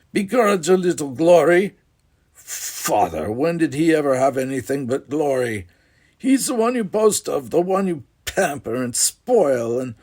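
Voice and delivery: mock the word glory with scorn; bitter anger. Jealous brother accuses father.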